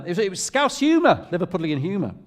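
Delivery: mum's excuse, pitched well up; Liverpudlian mum. Retold or imitated. Liverpudlian mum excusing her put-down as humour, high protesting voice. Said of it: "She said, um".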